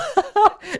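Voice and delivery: short high burst of disbelieving laughter; exasperated laugh. Real, not acted. Host laughing at the clip in disbelief, real laugh. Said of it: "you laugh to avoid crying".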